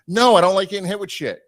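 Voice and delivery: flatly refuse, half-laughing; emphatic refusal. Retold or imitated. Retold blunt refusal.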